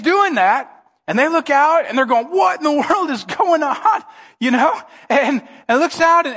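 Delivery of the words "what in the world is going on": high, drawn-out bewilderment; hammed-up baffled question; astonished disciples. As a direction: stretch out each word in mock amazement. Disciples baffled at figure on water.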